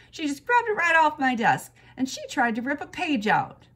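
loud, high indignant complaint; aggrieved kid pleading her case; indignant tattling. A narrator's reading. Girl tattles to teacher in quarrel.

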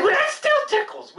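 ticklish squeal. Acted. Ear tickled by whisper.

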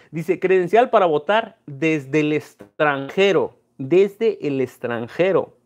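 slow, stressed reading of the card; reading aloud slowly. Real, not acted. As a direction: read each word off the ID slowly. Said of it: "reading his voter card aloud".

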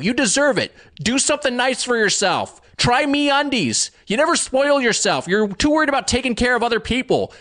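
high, sweet coaxing voice. Acted. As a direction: coax the listener like a life coach. Ad read turns to coaxing pep talk.

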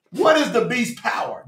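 hushed, mock-pompous question; quietly mocking their pomposity. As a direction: put on a soft, self-important air. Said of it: "This is how pompous they are".

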